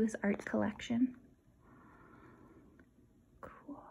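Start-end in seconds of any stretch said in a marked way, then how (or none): 3.4-3.8 barely audible whisper. Real, not acted. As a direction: breathe it under your breath. Quiet aside.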